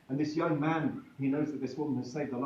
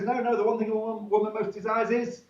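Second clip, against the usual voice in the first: eager woman; 9 semitones higher, 6 dB louder than usual. Acted. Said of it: "another woman says".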